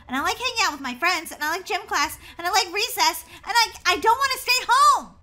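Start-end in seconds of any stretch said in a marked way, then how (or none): 3.4-5.1 high, loud whining outburst; upset protest. Acted. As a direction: wail that you refuse. Protests being homeschooled.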